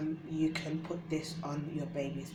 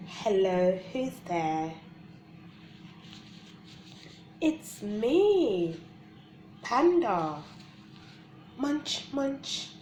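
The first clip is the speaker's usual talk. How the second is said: bright, high sing-song read-aloud; reading to children; 5 semitones higher, 4 dB louder, much slower than usual. Acted. Reading a baby book aloud.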